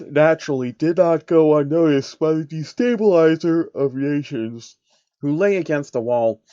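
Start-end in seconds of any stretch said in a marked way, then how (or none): none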